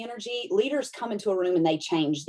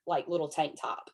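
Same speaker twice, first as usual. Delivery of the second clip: ordinary delivery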